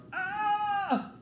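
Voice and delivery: very high startled yelp; gorilla yell. Acted. Gorilla bitten on the nose, high startled yelp. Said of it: "the gorilla yelled".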